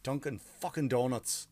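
ordinary talk, nothing marked

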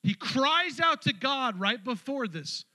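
slow, loud, high drawn-out line; emphatic preaching. Acted. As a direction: lean on every word.

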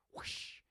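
loud, unvoiced whoosh; sharp burst of mouth noise; gunshot whoosh. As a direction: imitate a shot with a whoosh. Imitates the warning shot.

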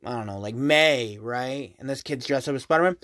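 dismissive under-the-breath mock commentary; mocking mutter. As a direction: mutter it like an unimpressed onlooker. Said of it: "we all look at them and go".

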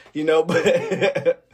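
real uneasy laughter breaking in; slow, trailing into an awkward laugh; uncomfortable laugh. Real, not acted. Admitting depression rates are high, laughing uncomfortably. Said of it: "I'm laughing 'cause it's uncomfortable".

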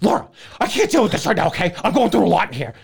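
high, fast, loud frantic snap; exasperated mock rant; mock frazzled outburst. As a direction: play him losing it over nothing. Mocking his stressed outburst at Laura.

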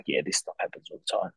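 breathless throwaway whisper; offhand whisper. Retold or imitated. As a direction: toss it off under your breath. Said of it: "and you're like".

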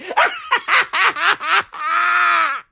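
burst of laughter. Real, not acted. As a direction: crack up at your own line. Laughing at own joke.

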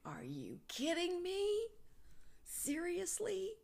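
very high, slowed incredulous rise; mock outrage, stretched way up high; incredulous disbelief. Mother arguing with her own inner voice, high incredulous disbelief.

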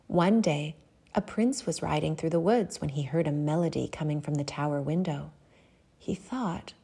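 ordinary delivery

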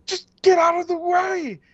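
very high screaming plea; imitated scream. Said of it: "just screaming at them".

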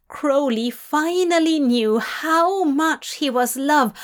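ordinary delivery

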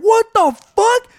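high, loud, drawn-out disbelief; mock astonished outburst; shocked reaction. Acted crowd shock at voice reveal.

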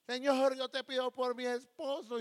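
high, loud, slow plea; fervent mock prayer. Wife praying for her husband, hammed-up fervent plea. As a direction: cry out to God in exaggerated pleading.

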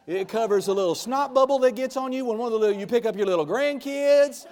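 rising to a loud, high, slow preaching shout. Real, not acted. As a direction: build it up and shout the blood of Jesus. Preacher builds to shouting climax.

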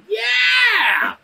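high, drawn-out cheer; triumphant yell. Retold or imitated. Kid delighted with his carved gun, triumphant yell. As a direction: let out a triumphant, high-pitched "yeah". Thrilled boy finishes carving gun.

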